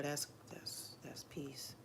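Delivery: whispered contentment; real soft whisper of calm. Real, not acted. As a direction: breathe it out in a whisper. Softly savoring a thought.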